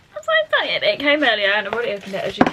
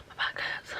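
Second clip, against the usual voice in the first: whisper; 12 dB quieter than usual. Real, not acted. Aside complaint.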